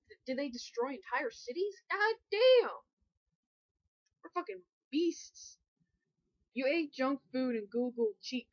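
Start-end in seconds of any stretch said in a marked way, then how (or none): 1.9-2.7 excited shout. Real, not acted. Excited outburst at destruction. 4.2-5.6 hyped. Real, not acted. Proud boast after outburst.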